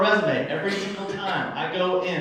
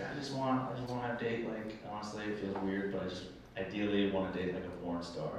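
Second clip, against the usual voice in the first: dropped to a much softer, confiding voice; sheepishly admitting something embarrassing; hushed confession; 8 semitones lower, 16 dB quieter than usual. Retold or imitated. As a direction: voice sinking lower and softer with each line. Buddy confessing his dating wish.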